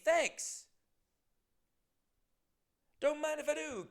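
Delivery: mock delighted acceptance; playful thanks. Podcaster cheekily thanking a listener's drink money, high.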